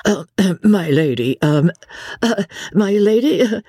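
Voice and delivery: feeble stammer. A narrator's reading. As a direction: falter helplessly between fillers. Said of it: "came in feeble accents".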